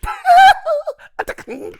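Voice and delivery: very high, light falsetto giggle; a squealing falsetto laugh that falls away. Retold or imitated. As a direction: do the star's high-pitched giggle. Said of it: "I'd never heard his laugh before".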